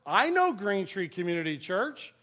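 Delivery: loud bystander butting in; pretty loud voice. Retold or imitated. Bagger overhears pastor's church name, pipes up loudly. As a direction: announce it loudly for all to hear. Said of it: "said in a pretty loud voice".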